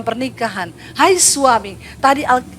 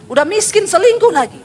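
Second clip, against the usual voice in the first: scolding preacher; 7 semitones higher than usual. Acted. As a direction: scold the men from the pulpit. Preacher scolding unfaithful husbands. (menegur dengan keras)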